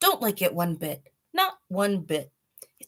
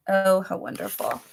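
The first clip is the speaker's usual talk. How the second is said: ordinary delivery, nothing marked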